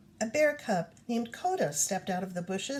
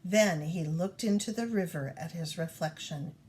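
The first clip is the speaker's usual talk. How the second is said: usual speaking voice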